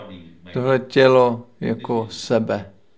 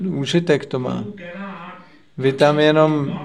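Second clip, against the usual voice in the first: ordinary delivery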